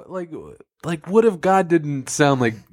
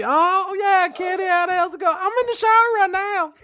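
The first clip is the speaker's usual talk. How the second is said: much higher, casual God. Acted. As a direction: chat high from the shower. High God on phone.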